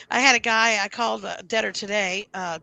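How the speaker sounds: ordinary delivery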